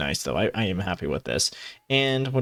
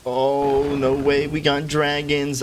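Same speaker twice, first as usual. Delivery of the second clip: excited; 5 semitones higher, slower than usual. Real, not acted. Dragons finally appear in game.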